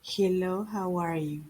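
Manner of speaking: monotone, uninterested-sounding greeting; neutral tone. Shown as a demonstration. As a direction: greet with no expression whatsoever. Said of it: "You don't say".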